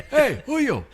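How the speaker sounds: high, loud mock Italian greeting; imitating the painter. Painter greeting the friend tomorrow, high and loud. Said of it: "He's gonna go".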